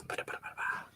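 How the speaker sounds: whispered scatting while typing. Real, not acted. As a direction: breathe a ba-da-ba tune.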